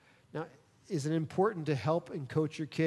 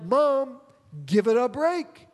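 raised, exasperated plea to mom; a son telling his mom to ease off, loud and fed up; loud exasperated "Mom, give it a break"; pleading with mom; 7 semitones higher, 4 dB louder, slower than usual. Retold or imitated. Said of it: "I'm like".

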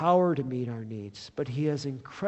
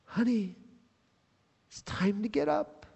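softer, higher tender wake-up; Jesus gently waking the dead girl, soft and tender; tender, hushed call; 5 semitones higher, 6 dB quieter than usual. Retold or imitated. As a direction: softly call her back to life. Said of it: "he takes her cold and lifeless hand".

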